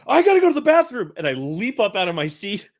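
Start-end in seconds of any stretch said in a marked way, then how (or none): 0.1-1.1 much higher, louder blurted excuse; leaping up like a small child with an excuse; eager excuse. Retold or imitated. Nervous groom blurting an excuse to slip away from the table, high and loud. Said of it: "And I go".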